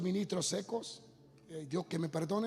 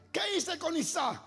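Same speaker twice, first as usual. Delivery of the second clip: God's insistent question; 10 semitones higher than usual. Acted. God quizzes him, acted dialogue.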